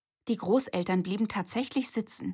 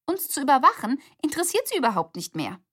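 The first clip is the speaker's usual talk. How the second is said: eager; 8 semitones higher, 6 dB louder, faster than usual. Acted. Sister pointing out an advantage.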